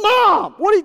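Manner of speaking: high, loud protest at mom; mock outrage. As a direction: yell at mom for cutting the line. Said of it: "And you're like".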